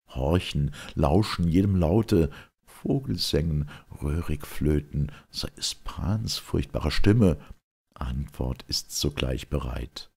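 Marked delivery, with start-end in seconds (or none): none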